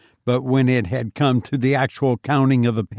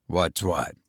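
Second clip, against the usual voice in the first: lower, quieter deflection; Fenwick curt; 6 semitones lower, 6 dB quieter than usual. Acted. Fenwick brushes off Foster's question, low and quiet. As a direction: answer low and soft.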